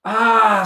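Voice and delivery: high, loud startled scream. A narrator's reading. Theo scared by the hiding boys, loud high scream. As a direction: shriek in fright. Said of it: "screamed Theo".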